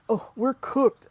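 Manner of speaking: high, loud mock despair; mocking doomer. Mocking people who say we're doomed, loud and high. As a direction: ham up someone saying all is lost. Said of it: "people who be like".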